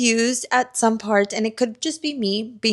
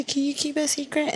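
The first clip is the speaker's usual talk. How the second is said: higher, sneering echo of his line; mocking quote; 6 semitones higher, 6 dB quieter than usual. Mocking the love interest's line, higher sneering echo. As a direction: throw his words back at him mockingly. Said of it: "How the fuck are you gonna say".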